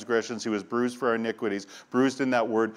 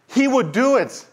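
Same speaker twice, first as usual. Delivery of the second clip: much higher, louder awestruck preaching; peak preaching fervor; 7 semitones higher, 5 dB louder than usual. Acted. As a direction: let the wonder break out at full force. Preaching climax, awe.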